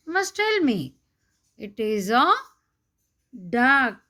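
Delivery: usual speaking voice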